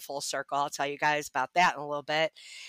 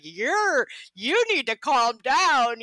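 higher, louder, drawn-out telling-off; retold firm order; 7 semitones higher, 4 dB louder, slower than usual. Katie to the upset new woman, retold firm order. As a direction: stretch it out, imitating her telling you to settle.

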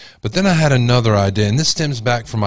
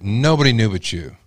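ordinary talk, nothing marked

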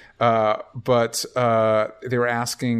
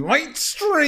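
high brand call. Acted. Brand name sung out.

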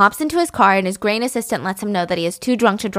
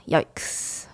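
hushed, voiceless wince; cringing whisper; 13 semitones lower, 11 dB quieter, much slower than usual. Real, not acted. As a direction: whisper it in embarrassed horror. Cringing at the replayed memory.

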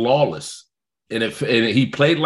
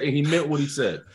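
ordinary talk, nothing marked

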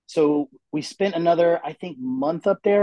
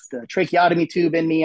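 ordinary delivery

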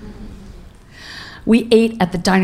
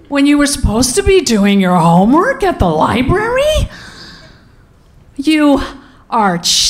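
inner outrage; 6 semitones higher than usual. Retold or imitated. Mother silently reacting to daughter's confession, raised incredulous outrage. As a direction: replay the shocked thought as an indignant parent. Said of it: "thinking to myself".

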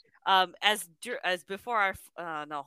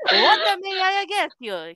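hammed-up thick Italian accent, higher and louder; 10 semitones higher, 9 dB louder than usual. Gas station clerk greets the heroine, hammed-up Italian accent.

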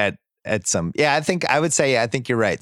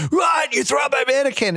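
much higher-pitched outraged mock yell; mock screaming; 13 semitones higher than usual. Killer at his grandmother over the mannequin, imitated screaming fit. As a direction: shriek it in hammed-up outrage. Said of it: "starts screaming at his grandmother".

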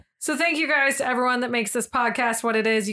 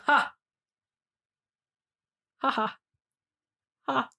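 saying ha instead of laughing; stifled giggles. Pretend. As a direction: hold in the laugh, just say ha. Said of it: "I'm trying not to laugh, so I'm saying ha".

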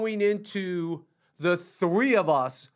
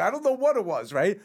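normal talking voice

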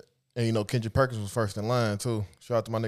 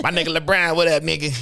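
sudden high, loud hype outburst; 7 semitones higher, 7 dB louder than usual. Acted. Imitating a rapper greeting [name] on air, suddenly hyped and loud. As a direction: leap up out of the mumble, hollering. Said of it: "he just get hype out of nowhere".

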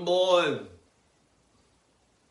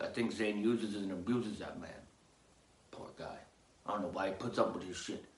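lower, softer gossip; conspiratorial aside; 8 semitones lower, 7 dB quieter than usual. Acted. Gossiping about Zane to listeners, hushed. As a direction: confide it behind Zane's back.

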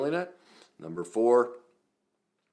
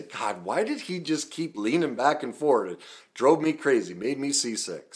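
higher, louder mock griping viewer; mock exasperation, voice raised; exasperated complaint; 10 semitones higher, 11 dB louder than usual. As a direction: imitate a fed-up commenter, pitched up and loud. Imagined viewer's complaint, acted out.

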